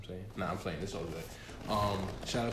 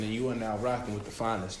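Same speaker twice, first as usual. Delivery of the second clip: ordinary talk, nothing marked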